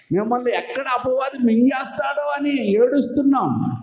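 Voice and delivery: పిలుపుగా గట్టిగా అరిచి చెప్పే బోధ; preaching shout. Real, not acted. Preacher cries out. (ఏడుస్తున్నాం అని గట్టిగా అరుస్తూ)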